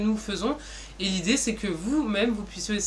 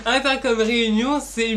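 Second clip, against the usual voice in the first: louder, words bubbling with laughter; laughing; 3 semitones higher, 6 dB louder than usual. Real, not acted. Presenter restarting her pitch through laughter. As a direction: get the name out loud through a laugh.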